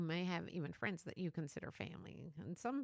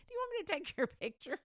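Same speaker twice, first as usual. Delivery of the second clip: high, fast laughing parody of herself; mock offer laughing; 10 semitones higher, 4 dB louder, faster than usual. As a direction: make fun of your own habit. Mocking her own habit, laughing.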